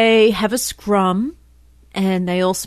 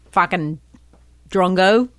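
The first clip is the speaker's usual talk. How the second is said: ordinary delivery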